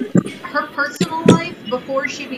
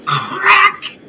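sudden louder, higher "crack"; spoken sound effect; 8 semitones higher, 8 dB louder than usual. Acted. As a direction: punch out the crack of an impact. Voices the crash as sound effect.

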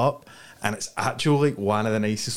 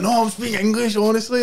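high-pitched retold protest; high, insistent mock pleading; 9 semitones higher than usual. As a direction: plead your case in a raised, comic pitch. Acts out protest about his language.

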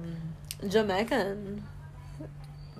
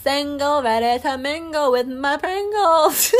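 put-on loud, slow, drawn-out Southern accent; 5 semitones higher, 6 dB louder, slower than usual. Introducing herself as single to listeners, loud Southern twang. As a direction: belt it out in a hammed-up Southern twang. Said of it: "Oh, accent's back".

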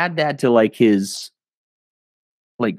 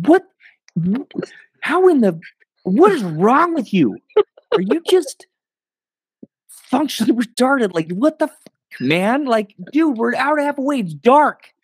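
much higher-pitched exasperated rant, mock furious; retold angry rant; 13 semitones higher than usual. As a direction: replay the meltdown at your companion, pitch way up. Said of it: "I just snapped, flipped out".

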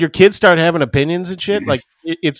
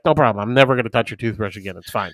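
low, quiet give-in to wife; placating agreement; 9 semitones lower, 7 dB quieter, faster than usual. Retold or imitated. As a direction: drop low and soft, conceding quickly. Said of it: "I just agree with her".

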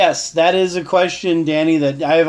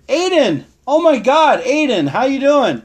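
higher, louder delighted welcome; excited greeting; 8 semitones higher, 4 dB louder than usual. Real, not acted. Greeting Aiden as he joins the live chat, thrilled.

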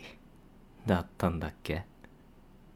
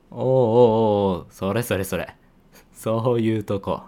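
loud, gleeful ribbing; loud teasing; 3 semitones higher, 8 dB louder than usual. Acted. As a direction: pounce on the reaction, laughing at it. 相手の反応を指差してからかう. (大声で楽しそうにからかう)

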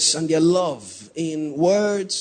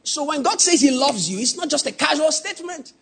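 louder, higher, driving preacher's cry; preaching at full force; 11 semitones higher, 4 dB louder than usual. Acted. Preacher presses the congregation on God's love, shouting. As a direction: build the point to the congregation.

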